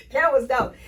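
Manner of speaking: usual speaking voice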